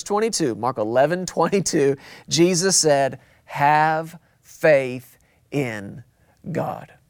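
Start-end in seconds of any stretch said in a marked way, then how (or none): 3.4-6.9 each word slow and weighty. Acted. Preacher giving the answer, slow and weighty. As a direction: lay "Have faith in God" down slowly. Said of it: "That's the answer".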